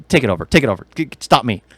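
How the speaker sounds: ordinary delivery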